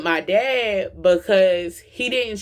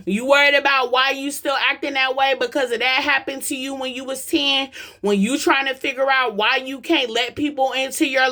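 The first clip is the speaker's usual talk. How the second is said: raised, urgent preaching voice; 5 semitones higher than usual. Acted. Preacher urging parents to hear their kids, raised and urgent. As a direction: preach it higher to wake the crowd up. Preaching to the crowd about healing.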